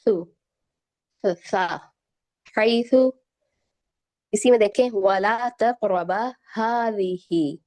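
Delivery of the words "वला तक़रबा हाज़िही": slow, drawn-out tajweed recitation; Quran recitation. Acted. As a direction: recite the verse slowly and melodically.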